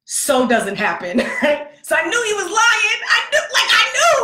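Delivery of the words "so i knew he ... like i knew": high, loud laughing yell; laughing outburst. Real, not acted. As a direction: burst out laughing in disbelief. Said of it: "laughing shout of disbelief".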